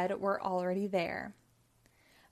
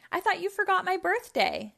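much higher, surprised outburst; exclaiming; 10 semitones higher, 4 dB louder than usual. A narrator's reading. Bob surprised by his party, exclaiming. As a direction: blurt it out in amazement. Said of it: "Bob exclaimed".

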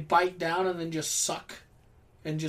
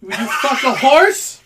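loud retort with a laugh in it; laughing shout; 7 semitones higher, 14 dB louder than usual. Real, not acted. Host laughing as he shouts back at his co-host.

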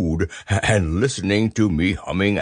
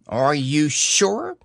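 higher, slow, puzzled question; a touch of surprise; surprised Rabbit; 6 semitones higher, slower than usual. A narrator's reading. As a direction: ask in mild surprise. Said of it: "still more surprised".

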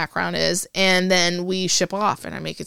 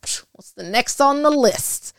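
slow, higher, breezy "psh" brushing off the setback; unfazed shrug; 7 semitones higher, 3 dB louder, slower than usual. Retold or imitated. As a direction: toss it off like no big deal. Shrugging off bad news.

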